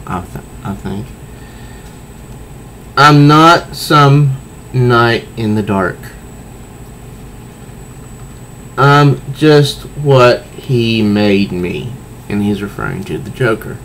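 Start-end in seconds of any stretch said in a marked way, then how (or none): 3.0-11.9 slow, weighty reading of a hero's confession; dramatic comic reading. Acted. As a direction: let each word land slowly. Reads Bruce's lines dramatically.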